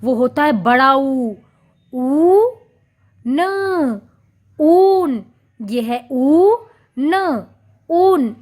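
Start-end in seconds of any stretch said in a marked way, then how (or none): 1.9-5.2 long ऊ, then न, then ऊन whole; syllable by syllable. Shown as a demonstration. Teacher spelling ऊन aloud to the class. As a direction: sound out ऊन as ऊ and न. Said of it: "ऊन किस तरह से लिखते हैं?".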